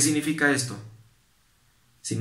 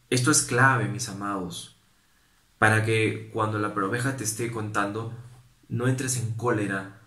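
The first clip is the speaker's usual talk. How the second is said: ordinary talk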